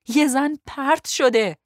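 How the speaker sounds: high, loud, drawn-out outburst. A narrator's reading. Passenger insisting a woman was thrown overboard, loud and urgent. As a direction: blurt it out forcefully, stretching the words. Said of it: "با صدای بلندتری گفت".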